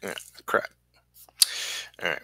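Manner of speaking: normal talking voice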